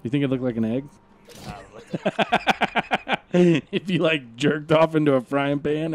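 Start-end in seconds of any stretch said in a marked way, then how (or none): 1.4-3.3 high, quiet run of laughter; long giggling fit in quick even pulses; laughing. Real, not acted. Cracking up at own frying pan joke, giggling.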